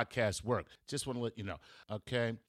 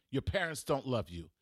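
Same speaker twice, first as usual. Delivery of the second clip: usual speaking voice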